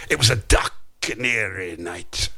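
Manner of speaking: put-on a half-hearted stab at a Scottish accent; failed Scottish accent. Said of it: "All I can do in Scottish is".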